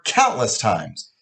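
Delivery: ordinary delivery, nothing marked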